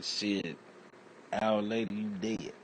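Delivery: ordinary talk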